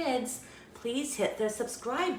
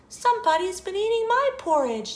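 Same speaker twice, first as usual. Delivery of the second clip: high, quick, sweet exclaiming; Mama Bear's sweet voice; 13 semitones higher, 4 dB louder, faster than usual. A narrator's reading. Mama Bear looks in her bowl, sweet high voice. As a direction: pitch it up sweetly, dismayed. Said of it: "in her sweet, low voice".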